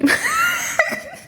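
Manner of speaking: sudden high squeal of laughter; high burst of laughter. Real, not acted. Laughing at her own phrasing, high squealing laugh.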